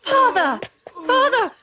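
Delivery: crying out for his father in alarm; calling out. Acted.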